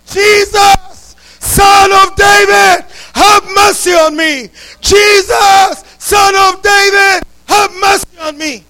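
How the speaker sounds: loud, drawn-out cry of Son of David; a blind beggar shouting for mercy over the crowd. Acted. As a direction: cry out loud for Jesus to hear you. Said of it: "he started shouting".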